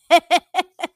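a loud, high burst of laughter. Real, not acted. Seeing Tina's new woman, she bursts out laughing loudly.

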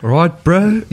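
put-on Maori-Kiwi accent, rising on "bro". Shown as a demonstration. Podcast guest greeting the host in a put-on Maori accent. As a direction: do a jokey Maori New Zealand accent. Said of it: "What accent do you think that is?".

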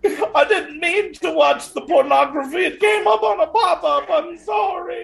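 high, wailing mock apology; pleading old woman. Old woman confessing to the priest, hammed-up wailing apology.

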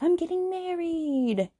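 high, slow, sing-song mom voice; slow, high mock announcement. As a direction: gush it like a giddy bride-to-be. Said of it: "her mom's all like".

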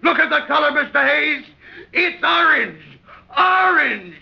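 a captain's furious roar, each word hammered; indignant shout. Acted. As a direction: blow up at the absurd suit. Captain outraged at orange suit.

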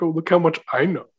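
lightly mock someone showing off; smug mock boast; sly smirk. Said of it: "such a sly, like, smirk".

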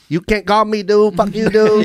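high mock Vietnamese accent, teasing; playful Vietnamese accent impression. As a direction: imitate the neighbor's thick Vietnamese accent. Imitating Vietnamese neighbor V.

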